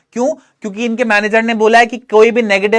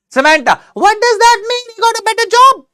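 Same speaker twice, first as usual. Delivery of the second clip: put-on much higher, slow, girlish character voice; Noori's voice; 13 semitones higher, 4 dB louder, much slower than usual. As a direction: play the worried friend, high and drawn out. Reading Noori's lines in voice. (ऊँची, धीमी लड़की की आवाज़)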